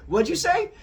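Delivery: loud, high, sudden query; startled question. Acted. Husband interrupted while eating, mock startled. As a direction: snap out of a daze, high and loud.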